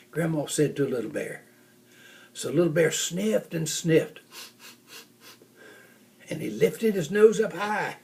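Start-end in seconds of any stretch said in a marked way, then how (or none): none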